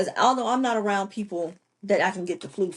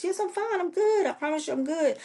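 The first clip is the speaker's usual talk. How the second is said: high-pitched girl insisting, retold; imitated insistence; 10 semitones higher than usual. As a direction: reassure auntie in a much higher voice. Girl insists she is fine.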